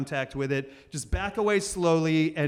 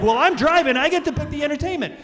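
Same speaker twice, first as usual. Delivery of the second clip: smug brain; 10 semitones higher, 7 dB louder, faster than usual. Retold or imitated. Brain voiced as a character.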